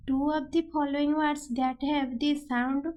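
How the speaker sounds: very slow, word by word; slow question read. Shown as a demonstration. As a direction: dictate the exam question slowly. Teacher reads exam question aloud.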